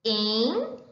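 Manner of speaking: "Enredo" as en, re, do with long pauses; syllable separation. Shown as a demonstration. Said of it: "a palavra enredo na separação silábica".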